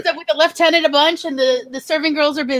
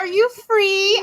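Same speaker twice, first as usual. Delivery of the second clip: lilting, higher camp shop-floor call; camp department store call; 7 semitones higher, slower than usual. Retold or imitated. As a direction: sing out the names like a camp shopwalker. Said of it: "my one woman cosplay of Are You Being Served".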